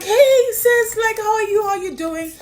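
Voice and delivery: high, loud, gushing hello to a celebrity; gushing greeting. Retold or imitated. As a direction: greet your idol like an old friend. Greeting Naomi on phone, overjoyed.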